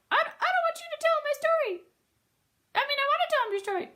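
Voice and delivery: very high, fast bird chatter. Acted. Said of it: "I like your bird voice".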